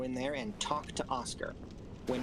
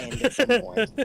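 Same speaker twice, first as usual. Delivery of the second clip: laughing; 12 semitones higher, 13 dB louder than usual. Real, not acted. Joking banter.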